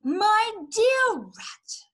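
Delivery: very slow, high, drawn-out address; mock-gentle menace. A narrator's reading. Fox toying with Rat before threatening him, mock-gentle. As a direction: stretch each word with false courtesy. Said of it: "he said softly".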